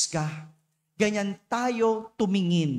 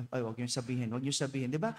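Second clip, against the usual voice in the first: mock hush; 9 semitones lower, 8 dB quieter, much faster than usual. Playful hush about the thief.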